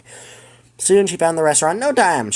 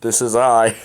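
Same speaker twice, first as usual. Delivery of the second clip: manly voice; 4 semitones lower than usual. Shown as a demonstration. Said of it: "such a manly voice".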